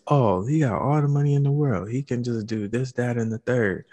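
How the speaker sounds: hammed-up quote, pitched well up; mimicking envious onlookers. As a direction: imitate people gushing over someone's money. Mimicking what people say about rich.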